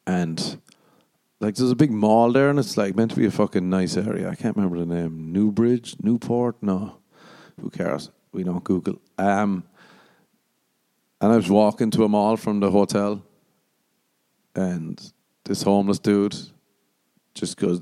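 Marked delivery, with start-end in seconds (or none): none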